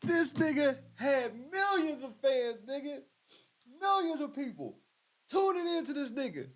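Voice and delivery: words pushed out mid-laugh; laugh-crying. Real, not acted. Marveling at his fanbase while laugh-crying.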